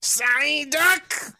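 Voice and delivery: high, loud cry hitting duck hard; overdone creature cry, heavy on duck; Psyduck impression. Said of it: "you went too hard on the duck part".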